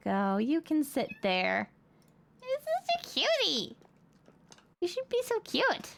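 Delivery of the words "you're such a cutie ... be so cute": high cooing praise. Real, not acted. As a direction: coo over the cutie. Praising pet.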